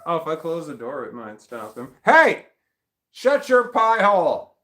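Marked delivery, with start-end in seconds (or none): 2.1-4.6 high, loud hammed-up parent scolding; mock scolding yell. Owner scolding the barking dog, mock-angry yell. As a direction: play the fed-up parent yelling down the hall.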